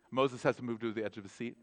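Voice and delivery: usual speaking voice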